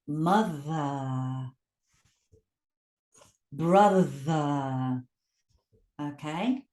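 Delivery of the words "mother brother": mother, brother drawn out low with buzzing th; slow, separate model words, long voiced th. Shown as a demonstration. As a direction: hum through the th in mother and brother. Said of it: "it's a sounded consonant".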